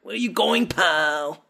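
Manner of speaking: high-pitched mock foreign accent, Arnold-like; Marco impression. Host proving Marco sounds Mexican, in a disputed mock accent. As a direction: do a broad, high-pitched movie-villain accent, Austrian-sounding. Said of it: "That's definitely Mexican".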